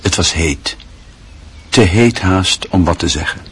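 ordinary talk, nothing marked

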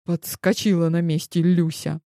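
plain reading voice

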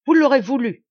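loud, high strident retort. A narrator's reading. Marquis falling on guard for the duel, strident. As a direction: raise it to a strident pitch. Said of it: "dit-il d'une voix stridente".

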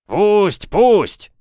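high, loud crowd cry; loud chorus-like shout of approval; agreeing yell. A narrator's reading. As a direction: yell your assent in short bursts. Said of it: "Согласным воплем отозвались".

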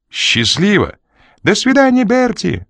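высокий визгливый выкрик; пропищать прощание на всю улицу; визг женщины. A narrator's reading. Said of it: "взвизгнула женщина". (женщина визжит вслед машине)

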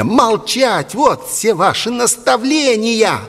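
put-on high-pitched, furious boy; angry yelling. A narrator's reading. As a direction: shout the priest down in a high voice. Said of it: "заорал Игнат".

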